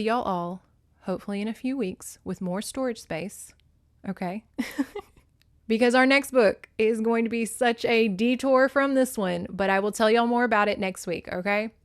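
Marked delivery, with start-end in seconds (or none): none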